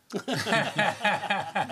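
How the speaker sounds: a loud, high burst of real laughter; laughing at own joke. Real, not acted. Teller laughs at his own baguette joke. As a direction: crack up at your own punchline.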